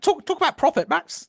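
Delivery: high-pitched mock royal impersonation; Princess Anne. Princess whispering in the host's ear about money, high impersonation. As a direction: pitch it well up, as a royal prompting in an earpiece. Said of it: "That's my Princess Anne impersonation".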